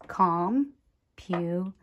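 "computer" with long pauses between com, pu and ter; one sound group at a time. Shown as a demonstration. Teacher laying a crayon per sound, "computer" in isolated syllables. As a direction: slowly place each syllable of "computer" separately. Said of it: "for each one of those sounds".

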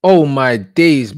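loud, slow, drawn-out disbelief; stunned oh my days. Real, not acted. Watching the free kick go in, loud slow disbelief. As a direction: drag it out in shock.

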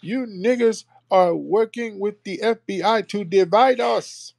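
high, slow, whiny mock accusation; hammed-up parody of a critic; mocking tethers. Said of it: "You always hear tethers hopping in our rooms".

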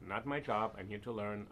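usual speaking voice

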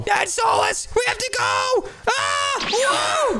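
high panicked yelling; panicked shout. Acted. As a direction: scream while running away. Fleeing dad, panic.

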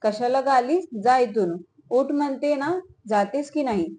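usual speaking voice

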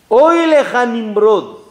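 slow, loud, high lament over Nimrod; a preacher crying woe on a king; solemn rebuke. Acted. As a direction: draw out "Oy leja Nimrod" like a prophet's warning. Abraham rebukes Nimrod again.

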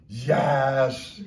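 loud, drawn-out "Yes"; hammed-up emphatic agreement; imitated local women. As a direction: stretch it out like a gossiping local. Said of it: "each one of them say".

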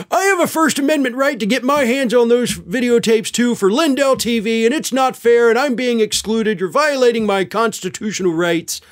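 lightly hammed-up indignant Mike Lindell; Mike Lindell impression. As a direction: whine about your rights like a wronged man. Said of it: "My Mike Lindell impression".

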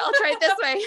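laughing while talking. Real, not acted. Laughs at own camera fumble.

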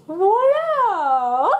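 high, loud flourish; triumphant French voila. Acted. Madame Gazelle lands her ski jump, triumphant. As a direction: announce it proudly.

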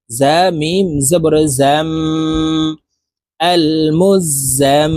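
slow, with the m of জাম and মুজাম held as a nasal hum; nasal ghunna. Shown as a demonstration. Teacher showing the three-second hold, nasal ghunna. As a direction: hum the final m through the nose for seconds. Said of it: "তিন second পরিমাণ গুন্না করে ধরে রাখবো".

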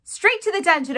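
high, loud shout from the crowd. A narrator's reading. Another mouse joining the clamor, shouting. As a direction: shout out a condemnation. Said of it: "another voice shouted".

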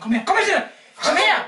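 loud, high laughing yell; acted shout. Prankster yelling at Jonas, laughing shout. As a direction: call him out, barely holding the laugh.

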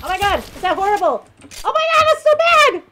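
high, loud panicked squeal; real squealing despair; shrieking dismay. Real, not acted. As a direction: yelp it out, horrified at your own aim. Frustrated gamer sees bad aim.

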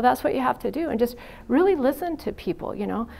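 usual speaking voice

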